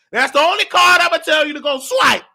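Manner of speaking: normal talking voice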